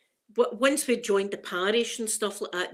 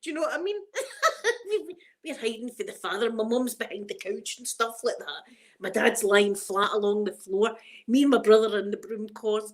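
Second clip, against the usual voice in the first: ordinary delivery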